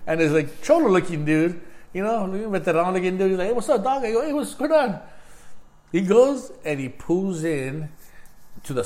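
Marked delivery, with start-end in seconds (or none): 3.5-4.0 high, friendly street greeting; a lightly voiced friendly dude; casual greeting voice. Voicing the guy's greeting. 4.2-5.0 high, cheerful hello; a high, upbeat casual reply; friendly greeting. Retold or imitated. Own retold greeting.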